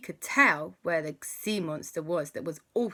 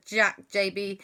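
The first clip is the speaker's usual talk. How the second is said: plain reading voice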